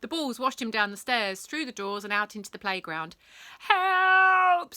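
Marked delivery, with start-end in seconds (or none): 3.6-4.8 high, loud cry for help; screaming. Acted. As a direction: yell for help while being swept away. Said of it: "screamed Pent".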